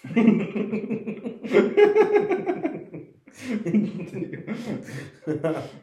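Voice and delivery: high, loud helpless laughter; long burst of laughter. Real, not acted. Laughing hard after her friend calls herself the provoker. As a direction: crack up at the punchline. Friend admits being the scum.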